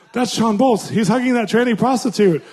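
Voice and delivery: high, loud, laughing mock shock; scandalized gossip. Conference Christians spotting him with a prostitute, loud laughing mock shock. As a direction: gasp it like catching him in scandal.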